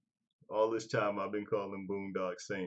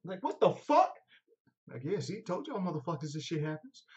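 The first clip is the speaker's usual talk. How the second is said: high, loud shrieking disbelief; hammed-up startled outburst; mock shock; 10 semitones higher, 9 dB louder than usual. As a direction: squeal it like you can't believe it. Acting shocked guest reaction.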